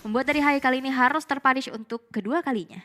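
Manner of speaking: rising hype on the big play; esports caster. Acted. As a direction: call the action, build to the kill. Casts the match play-by-play. (komentator esports yang bersemangat)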